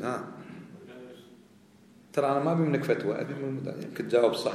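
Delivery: usual speaking voice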